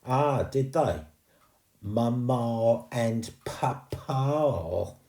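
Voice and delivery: slow smug mocking drawl; smug ghost mocking her posh words. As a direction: savour the words smugly. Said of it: "in a rather smug tone".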